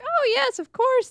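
gushing, pitched well up; mock sweet agreement. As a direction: sing out high and sugary. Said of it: "before he was like".

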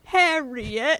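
laughing Scottish "Harriet" with a rising end; a giggly Scottish "Harriet", rolled r; Scottish accent. Retold or imitated. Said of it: "quoting movie line".

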